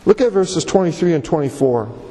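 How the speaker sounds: usual speaking voice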